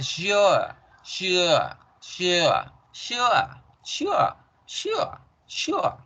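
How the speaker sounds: teacher drilling sure, rising in pitch; English sure. Shown as a demonstration. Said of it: "sino es con la Z alargada".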